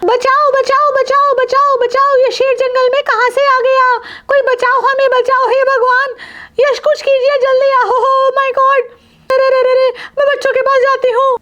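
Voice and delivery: very high-pitched shrieking for help; panicked screaming. Acted. Mother cries for help from a tiger, panicked high scream. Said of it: "अगर तुम ऐसे चिल्लाओगी और घबराओगी".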